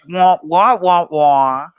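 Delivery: muffled trombone-like wah wah; muffled wah wah talk. Retold or imitated. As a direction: Charlie Brown teacher voice, wah wah. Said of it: "Charlie Brown character".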